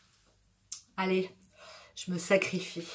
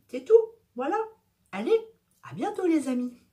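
playful exclamation; 4 semitones higher than usual. Real, not acted. Playful sign-off after pitch.

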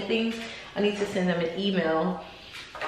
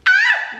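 delighted gasp; 6 dB louder than usual. Real, not acted. Opening stunning packaging.

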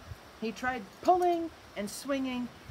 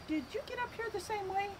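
high, quick curious question; Floyd asking the cat; 11 semitones higher, 5 dB quieter, faster than usual. Acted. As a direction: ask the cat brightly and fast. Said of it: "he's asking the cat".

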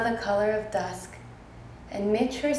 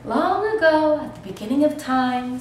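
higher, louder voice opening a tale; storytelling star; 5 semitones higher, 6 dB louder than usual. A narrator's reading. Star begins telling Sulwe an old tale, in a raised storyteller voice. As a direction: launch the legend grandly, high and loud.

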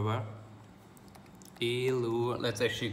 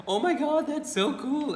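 much higher, wide-eyed gushing; mock-dazzled high voice, mild; naive amazement; 4 dB louder than usual. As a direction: play the clueless tourist, thrilled. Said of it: "And I'm just like".

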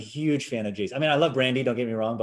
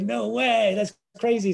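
much higher, mock-astonished; amazed; 12 semitones higher, 4 dB louder, slower than usual. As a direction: act blown away by the news. Producer reacts in amazement.

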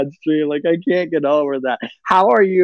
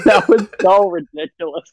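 ordinary talk, nothing marked

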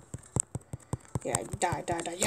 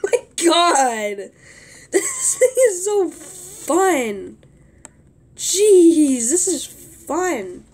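delighted; 6 semitones higher, 6 dB louder, much slower than usual. Real, not acted. Delighted with new item.

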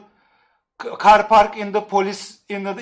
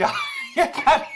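a real laugh breaking into the word yeter, high; giggling out yeter as if chat can't be stopped. Real, not acted. Streamer laughing at his chat, telling them enough.

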